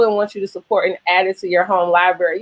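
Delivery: usual speaking voice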